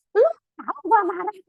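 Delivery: high, loud mock outrage; mock angry shriek. Wife mocking her husband's dismissiveness with high loud outrage. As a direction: squeal it like an offended wife.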